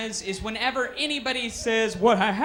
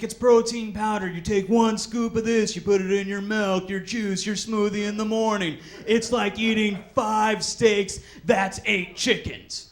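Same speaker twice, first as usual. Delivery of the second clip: louder, slow hammed-up gym bro; pushy salesman; 6 dB louder than usual. Gym salesman overselling protein powder, slow and loud. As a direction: oversell every word slowly.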